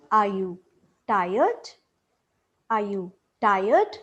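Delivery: TIRED sweeping up at the end; rising tone. Shown as a demonstration. Teacher modelling a second yes-no question, rising tone. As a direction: ask it with the pitch climbing on "tired". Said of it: "this is the example of rising tone".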